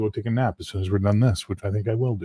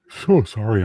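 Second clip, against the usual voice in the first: ordinary talk, nothing marked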